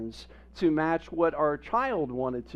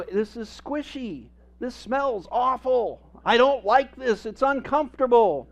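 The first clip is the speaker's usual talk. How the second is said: put-on baby complaining; 7 semitones higher, 5 dB louder than usual. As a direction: play the fussy baby who hates a dirty diaper. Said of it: "They go".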